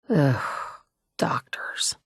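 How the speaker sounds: breathy under-the-breath jab; whispered aside. Acted. Survivor muttering about doctors, whispered. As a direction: whisper it with disdain, just for yourself.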